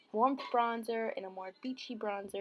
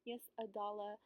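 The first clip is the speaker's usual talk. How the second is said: normal talking voice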